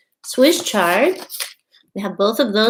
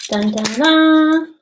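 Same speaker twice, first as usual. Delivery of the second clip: playful dun dun dun rising to a louder high dun; mock drumroll; 6 semitones higher, 4 dB louder, much slower than usual. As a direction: hum a little fanfare for the reveal. Mock drumroll before seed reveal.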